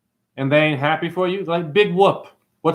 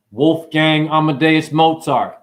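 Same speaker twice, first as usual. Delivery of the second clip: usual speaking voice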